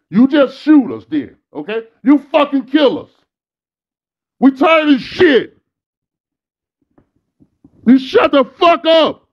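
high, furious yelling, real rage; angry shouting. Real, not acted. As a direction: shout it at them, fed up and furious. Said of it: "the inflection and shit".

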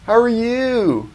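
high, slow, sugary greeting; fake-nice dentist. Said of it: "It's like".

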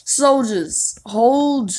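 British commander. Pretend. Commanding British troops in a loud British accent. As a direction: bark it like a British officer. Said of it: "talking in a British voice".

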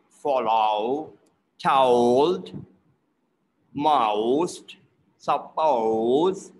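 "Go" and "boat" drawn out from ah into rounded oh; "boat" as a long "ba-out", "window" as "win-dow"; exaggerated O diphthong. Shown as a demonstration. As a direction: stretch the O in "told", "most", "hello" as a dipping ah-oh glide. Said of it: "So it's R O".

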